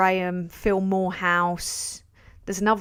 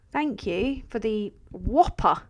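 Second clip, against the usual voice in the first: ordinary talk, nothing marked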